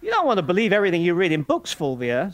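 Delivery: blustering Morris. A narrator's reading. Morris fends off Fulvia in loud, fast bluster. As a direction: brush her off with quick bluster.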